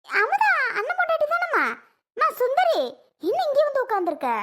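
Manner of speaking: high, surprised call across to her. Acted. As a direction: call her name in startled recognition. Neighbour calls out recognising Sundari. (பதற்றத்துடன் உரத்த அழைப்பு)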